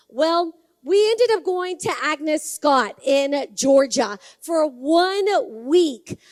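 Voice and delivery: ordinary talk, nothing marked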